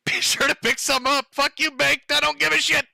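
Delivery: high-pitched, hammed-up indignation; mock defiant outburst. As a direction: throw a put-on high-voiced fit at a rival. Mock meltdown turned on Baked.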